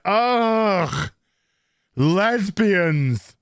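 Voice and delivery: mock disgusted sigh; disgusted groan. [name] storming off, mock disgusted groan.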